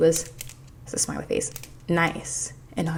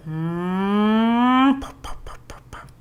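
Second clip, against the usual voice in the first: imitated car noise; 4 semitones higher, much slower than usual. Vlogger imitates the annoying Miami car, humming then popping. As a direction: mimic an engine revving up and popping. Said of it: "this annoying car sound that's like".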